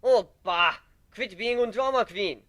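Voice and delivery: a hint of a blustery old friend; gruff brush-off. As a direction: wave away his self-pity.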